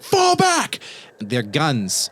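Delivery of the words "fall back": high, loud yelled order; mercenary's battle shout. Acted. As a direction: yell the retreat over gunfire. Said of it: "these armed mercenaries are like".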